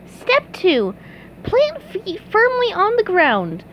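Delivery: high cartoon voice reading out instructions; SpongeBob reading. A narrator's reading. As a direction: read each step aloud in character.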